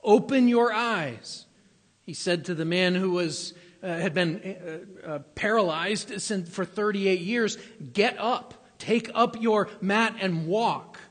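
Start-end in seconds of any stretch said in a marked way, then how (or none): 0.0-1.5 raised, slow command "Look around". Acted. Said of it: "commanded the man to see". 7.9-11.0 raised, slow command. Acted. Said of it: "He just commanded him".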